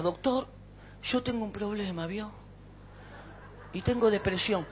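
very soft, subdued patient voice; depressed patient. Retold or imitated. Patient telling the doctor of his depression, quiet and downcast.

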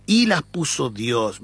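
"Y" stressed and slowed. Shown as a demonstration. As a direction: lean on the "Y", read slowly. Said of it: "Yo voy a darle énfasis en la "y". (la "Y" enfatizada y lenta)

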